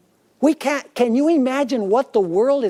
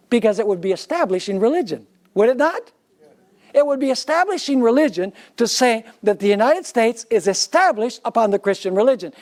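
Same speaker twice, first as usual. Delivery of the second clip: normal talking voice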